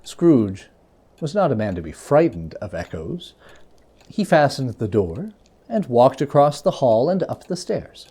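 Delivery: usual speaking voice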